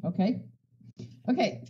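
ordinary talk, nothing marked

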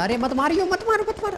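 urgent plea climbing very high; beg, rising into a squeal; high-pitched pleading. Real, not acted. Player begging enemies not to shoot, high pleading.

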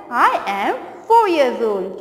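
put-on "I am four years old" spoken very slowly; slow model answer. Shown as a demonstration. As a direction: say it slowly so kids can repeat. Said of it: "हाँ, तो क्या बोलना है?".

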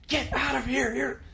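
high sharp mock snap; snapping. Mother at her devotions snapping at her child, high and sharp. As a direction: bark the kid out of the room. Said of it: "She'd snap".

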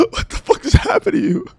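high, loud laughing disbelief; laughing shock. Real, not acted. Laughing shock at fainted friend.

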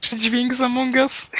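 ordinary talk

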